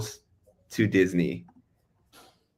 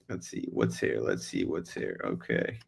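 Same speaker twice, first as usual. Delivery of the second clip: muttering; 11 semitones lower, 9 dB quieter than usual. Real, not acted. Mutters to self browsing map.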